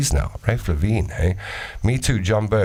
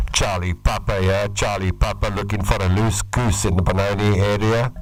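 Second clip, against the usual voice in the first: CB radio caller, lightly put on; CB radio trucker voice. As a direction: call out over the CB like a trucker. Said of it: "Hey, you went like".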